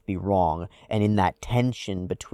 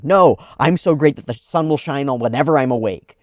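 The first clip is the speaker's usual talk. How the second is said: loud, indignant self-praise; pissed off; 6 semitones higher, 9 dB louder than usual. Retold or imitated. Imitating an angry person denying reality, loud and indignant. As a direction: huff it out like you're offended. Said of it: "you get pissed 'cause you're like".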